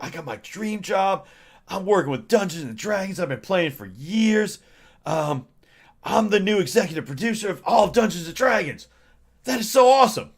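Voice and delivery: high, bright-eyed gush of a new hire; giddy Kyle. Retold or imitated. Excited new executive producer bragging about his dream job, high and giddy. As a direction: gush like you just landed your dream job.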